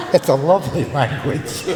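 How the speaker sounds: high, breathy delighted laughter; laughing. Real, not acted. Amused grandmother laughing at her own Gaelic insults.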